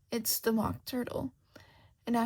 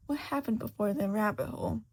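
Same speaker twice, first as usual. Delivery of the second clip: usual speaking voice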